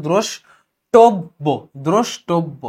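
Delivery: দ্রষ্টব্য broken slowly as "drosh-tob-bo"; pronunciation demo. Shown as a demonstration. Teacher showing the o-sound, দ্রষ্টব্য said syllable by syllable. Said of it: "ও এর উচ্চারণ হচ্ছে".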